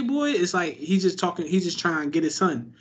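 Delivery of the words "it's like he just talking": ordinary talk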